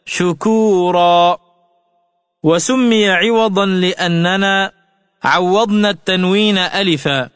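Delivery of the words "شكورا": شكورا sung out as shakūrā with a long final a; pausal reading. Shown as a demonstration. Teacher shows the class how شكورا sounds at a pause, recited. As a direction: chant شكورا with the end stretched. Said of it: "فتلفظ عند الوقف هكذا".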